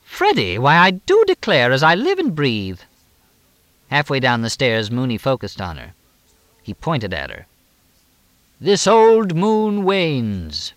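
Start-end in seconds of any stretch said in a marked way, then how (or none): 0.0-2.8 high, loud, theatrical astonishment; exclaiming. A narrator's reading. Edith Howell greets Freddie on the stairs, exclaiming in astonishment. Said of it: "Edith Howell exclaimed". 8.6-10.7 high, loud and very slow oratory; theatrical declaiming. A narrator's reading. Mooney pronounces from the stairs at Edith, declaiming theatrically.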